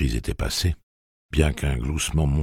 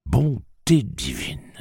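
slow, higher gasp of wonder; astonished; 8 semitones higher, 3 dB louder, much slower than usual. Acted. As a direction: marvel at the talking train. Bob astonished.

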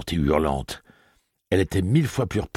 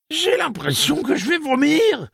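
voice cracking; 4 dB louder than usual. Acted. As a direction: voice jumping high like a breaking teen voice. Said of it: "d'une voix qui muait d'un extrême à l'autre".

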